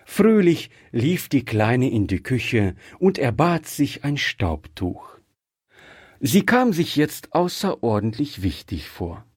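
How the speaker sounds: usual speaking voice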